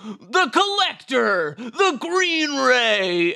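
slow, high hype announcer; monster truck ad. Pretend. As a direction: stretch each name like a rally ad. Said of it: "Eric Romare Monster Truck Rally".

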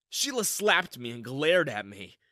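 usual speaking voice

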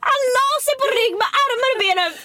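high, squealing through laughter; helpless laughter. Real, not acted. Telling a friend how he lay down to scream, helpless laughter. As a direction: barely get it out, crying with laughter.

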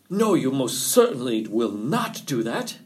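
defiant rabbit, firmly emphatic. A narrator's reading. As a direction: refuse with swinging, emphatic stress. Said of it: "said Rabbit defiantly".

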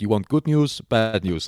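loud imitation of a Romanian builder offering news; put-on Romanian accent, lifted pitch. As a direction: pose the choice in a mock Romanian accent. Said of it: "is that a Romanian accent".

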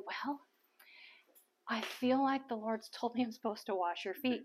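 acted timid confession, leaning in close; hushed, hesitant. Speaker leans in to the older woman, hushed and hesitant.

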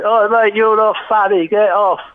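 loud, high Cockney heckler yelling from the crowd; Cockney heckle. Retold or imitated. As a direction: bellow it like a Cockney lad in the audience. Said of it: "some broad Cockney accent say".

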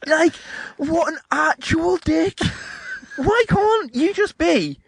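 very high, drawn-out indignation; exasperated outrage. Real, not acted. Friend ranting about the Hoff walking out, exasperated outrage. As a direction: squeal it slowly in disbelief.

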